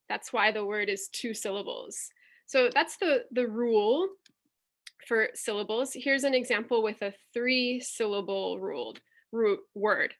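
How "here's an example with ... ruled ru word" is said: ordinary talk, nothing marked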